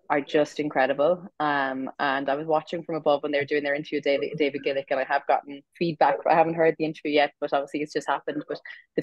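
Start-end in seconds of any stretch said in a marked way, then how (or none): none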